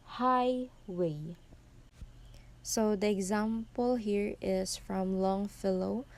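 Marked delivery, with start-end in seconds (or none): 0.1-1.4 "highway" drawn out, first syllable high and strong; stress on first syllable. Shown as a demonstration. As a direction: punch the first syllable of "highway", stretching the word. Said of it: "So it is pronounced as".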